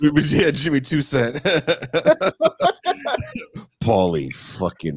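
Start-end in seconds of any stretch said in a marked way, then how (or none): 1.5-3.8 high rising laughter; real laugh. Real, not acted. Host laughing at the play idea, high laugh.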